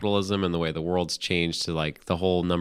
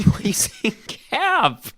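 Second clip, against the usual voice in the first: high, disbelieving squeal; pitched-up mock disbelief; incredulous; 14 semitones higher, slower than usual. Real, not acted. Incredulous at slang.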